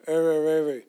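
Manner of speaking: words smeared into one blurred growl of sound; garbled speech. Retold or imitated. Imitating dying aunt trying to speak, garbled. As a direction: imitate slurred, unintelligible mumbling. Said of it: "she'd speak like".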